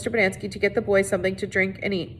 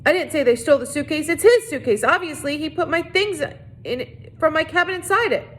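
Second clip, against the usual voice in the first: higher, defensive warden voice; lightly voiced flustered warden; 7 semitones higher than usual. A narrator's reading. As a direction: backpedal fast, voice climbing.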